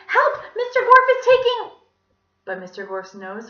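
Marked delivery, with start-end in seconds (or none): 0.1-1.8 put-on high, loud child calling out; urgent cry for help. A narrator's reading. Allison calling to the teacher through the door, urgent. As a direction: yell desperately in a little girl's voice.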